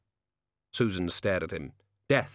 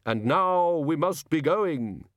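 slow, raised, final farewell; solemn Death; 7 semitones higher, 3 dB louder, slower than usual. Acted. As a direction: cut in with drawn-out finality. Death cuts Susan off, leaving.

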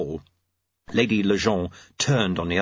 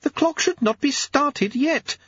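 high, slow ladyship voice; high-pitched formal protest; Lady Lejeune; slower than usual. Acted. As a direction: insist in a high, deliberate lady's voice. Lady Lejeune insists in character.

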